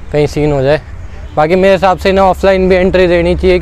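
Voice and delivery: ordinary delivery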